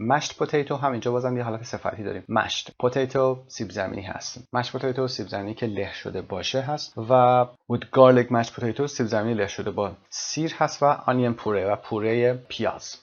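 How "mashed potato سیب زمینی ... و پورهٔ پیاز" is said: normal talking voice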